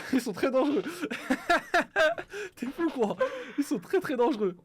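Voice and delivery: laughing. Real, not acted. Rit en parlant.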